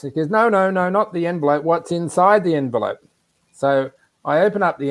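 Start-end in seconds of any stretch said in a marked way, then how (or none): 0.3-3.0 imitated hard-of-hearing man, high-pitched and insistent; mock the raised voice of a friend correcting you; Alan's loud talk. Hard-of-hearing friend explaining about the envelope, imitated raised voice. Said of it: "he speaks quite loudly".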